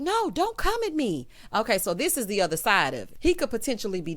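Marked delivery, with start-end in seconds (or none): 0.0-1.3 higher, pleading imitation of a scared woman; lightly voiced frightened pleading; pleading fiancée. Imitating the fiancée begging him in the restroom, higher.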